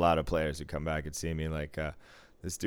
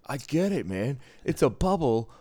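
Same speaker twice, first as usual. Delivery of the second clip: loud, high-pitched imitation of an excited coworker; mock-insist on the joke, pitched up and loud; over-eager explaining; 7 semitones higher, 7 dB louder than usual.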